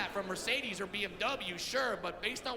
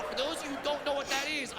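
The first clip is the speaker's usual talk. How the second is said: normal talking voice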